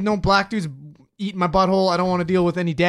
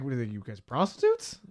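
voice rising in disbelief; 5 semitones lower, 9 dB quieter than usual. Retold or imitated. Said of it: "is it, my voice".

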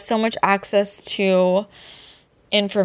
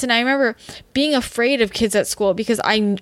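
ordinary talk

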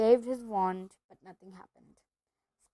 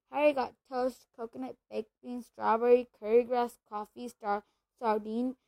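ordinary delivery

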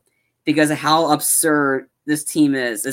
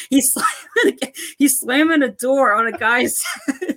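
high-pitched, cracking up mid-sentence; laughing while talking; 11 semitones higher than usual. Real, not acted. Retelling a door-slamming gag to a co-host, helplessly laughing. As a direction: tell it through real laughter.

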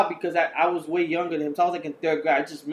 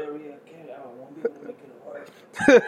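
faint, unvoiced sick mumble; 3 semitones lower than usual. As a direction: croak like you have a stomach bug. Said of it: "you put the sick voice".

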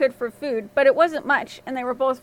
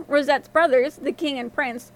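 usual speaking voice

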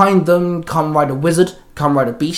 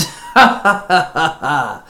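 villain chuckle. A narrator's reading. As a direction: laugh at them, pleased with himself. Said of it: "Rosfer chuckled".

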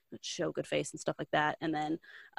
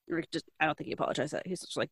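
usual speaking voice

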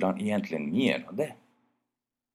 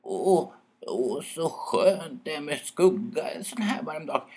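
relieved owl sigh; 8 semitones higher than usual. Acted. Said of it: "pustade ut och sa spontant".

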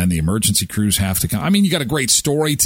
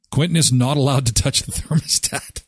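ordinary talk, nothing marked

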